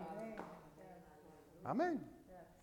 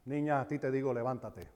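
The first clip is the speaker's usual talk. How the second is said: gentle command; 6 semitones lower, 13 dB quieter than usual. Retold or imitated. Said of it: "Le dice".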